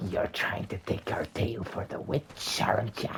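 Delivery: suspicious hushed rat voice; whispering rats. Acted. The rats question Cinderella in a sly whisper. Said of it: "they're like".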